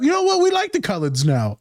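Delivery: starts very high, then settles. Acted. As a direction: lightly put on a high announcing voice.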